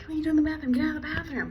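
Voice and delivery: high-pitched mock telling-off; mock scolding. Acted owner scolding the dog.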